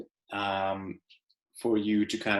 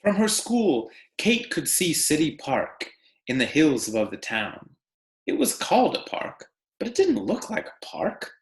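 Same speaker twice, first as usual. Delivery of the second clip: story read aloud; 3 semitones higher than usual. Acted. Teacher reading The Tree Lady to her class, expressive read-aloud. Reads picture book to class.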